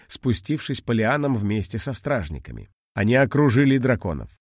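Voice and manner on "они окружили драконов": usual speaking voice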